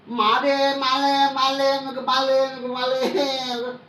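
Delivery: high, drawn-out mock wail; mock crying. Speaker mocks a beaten rival to the crowd, wailing mockingly. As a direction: whine it out slowly, mimicking a crybaby rival. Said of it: "कुत्ते क्या रो रहा था".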